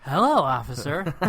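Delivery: loud, breezy imitated greeting; mock nonchalant. Retelling Bodie blocking an officer, loud mock-nonchalant greeting. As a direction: toss out a casual hello, hammed up. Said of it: "nonchalantly, he goes".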